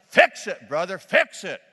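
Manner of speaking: loud shouted call from the pews; yelled. Retold or imitated. Man on the second row yelling at the preacher, loud call. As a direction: yell it across the church at the preacher. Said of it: "stood up and yelled".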